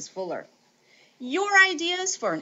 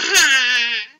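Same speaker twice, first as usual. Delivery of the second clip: loud laugh; 3 semitones higher, 10 dB louder than usual. Real, not acted. Laughs at her own clock joke.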